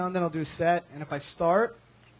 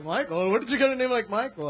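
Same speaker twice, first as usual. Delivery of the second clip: baffled mock Indian accent, climbing higher; fake Indian accent; 4 semitones higher than usual. Crew questioning the comic's name in a mock Indian accent. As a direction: put on an incredulous Indian accent. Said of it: "that fake accent part".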